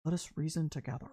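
low, soft, soothing summons; voice of God. Retold or imitated. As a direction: imitate God calling softly from below. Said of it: "then you hear that voice say".

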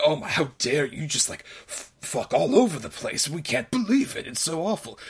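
hammed-up outrage, kept fairly soft; mock scandalized. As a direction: imitate prudes berating her. Mimics characters berating the housekeeper.

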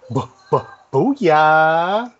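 stuttering build into a shout; excited shout. Real, not acted. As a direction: booyah. Building to a cheer.

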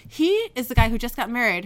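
ordinary delivery

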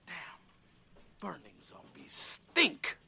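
low, slow, disgusted whisper; whispered aside. Acted. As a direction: mutter it under your breath, slowly. Disgusted aside after fight.

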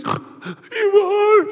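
put-on high, small child's answer; small boy. Son answering his dad's question in a high child voice. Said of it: "He goes".

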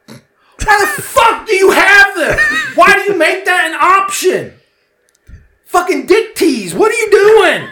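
very high, loud comic screaming; acted meltdown, drawn out and squealing; furious rant. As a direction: shriek it slowly in hammed-up outrage. Acted rant at parking meters.

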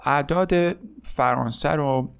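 ordinary talk

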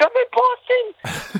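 high, slow, wailing fake panic. Kid on set faking panic to the crew, high slow wail. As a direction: ham up panic like a terrified kid. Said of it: "pretending to panic".

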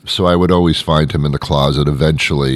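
normal talking voice

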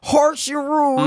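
high, slow sneering verdict; slow mocking drawl. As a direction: stretch it out, mocking. Mock judgement, drawn out.

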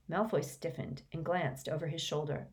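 ordinary delivery, nothing marked

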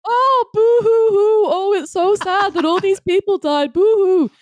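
high, slow mock boo-hoo sobbing; fake crying. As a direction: whine it out like fake tears.

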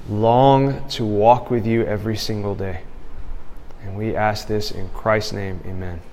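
soft, earnest spoken prayer. Acted. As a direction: pray quietly and sincerely. Leads the class in prayer.

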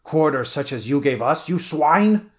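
loud fury climbing to a high pitch; frenzy. A narrator's reading. Stranger about to butcher his enemy, mounting frenzy. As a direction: build to a furious high, loud insult. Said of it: "There was a quiver of frenzy in the stranger's voice".

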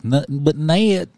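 West Virginia drawl. Retold or imitated. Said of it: "speaking of drawl".

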